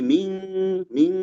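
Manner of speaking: MING, the n hidden towards a held ng; hidden n, ikhfa. Shown as a demonstration. Tajweed teacher showing ikhfa, "ming" with nasal ng held. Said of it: "dekat ke eng ikhfa itu ya".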